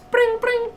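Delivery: very high trilled "brring"; imitated phone ring. Caller left on hold, imitated ringing. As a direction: trill like a telephone.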